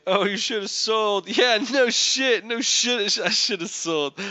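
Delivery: high-pitched mock exasperation; hammed-up indignant forum tirade; exasperated rant. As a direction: rant it defensively, pitch pushed up.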